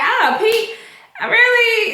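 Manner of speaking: can't believe what he just did; laughing disbelief. Real, not acted. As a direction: viewer cracking up at a character's goofiness, incredulous. Viewer reacting in disbelief.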